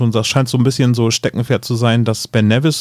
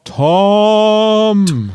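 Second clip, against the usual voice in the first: high loud call into the beyond; 11 semitones higher, 4 dB louder than usual. Pretend. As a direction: summon the spirit with a long cry. Calling the spirit at a seance.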